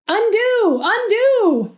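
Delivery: high, loud panicked scream; frantic loud high yell. A narrator's reading. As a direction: shriek it in pure panic. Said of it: "he screamed".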